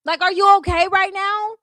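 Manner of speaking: high, loud disbelief; mocking disbelief; incredulous. Real, not acted. Incredulous reaction to clip.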